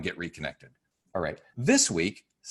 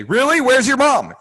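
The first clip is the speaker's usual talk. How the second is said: loud, much higher shouting; too loud at a kid; 13 dB louder than usual. Shown as a demonstration. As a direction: blast the question at a lost child. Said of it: "You wouldn't do that".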